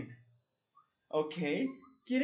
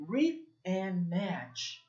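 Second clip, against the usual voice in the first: slow, careful English reading; slow English model; much slower than usual. Shown as a demonstration. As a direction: read the instruction slowly. (lectura lenta en inglés)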